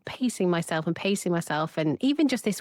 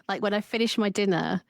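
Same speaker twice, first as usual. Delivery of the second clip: normal talking voice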